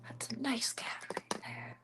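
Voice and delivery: hushed whisper of grandpa's praise; whispered repeat. Shown as a demonstration. Repeating grandpa's praise of the cat in a whisper. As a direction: breathe it out in a whisper.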